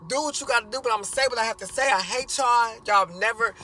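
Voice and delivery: loud and high, spitting every word out; angry shouting. Retold or imitated. Son yelling at parents who keep beating him, furious shout. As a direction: yell it all out, holding nothing back. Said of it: "still speaking his mind".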